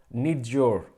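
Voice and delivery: slow "need your" with the y of "your" sounded; repeating the corrected "need your" slowly; careful corrected pronunciation. Shown as a demonstration. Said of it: "Thank you for your correction".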